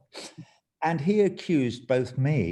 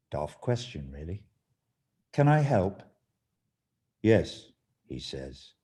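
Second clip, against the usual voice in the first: reading his parable aloud, dramatic and measured; story reading; 5 dB louder than usual. Acted. Reads his short parable aloud.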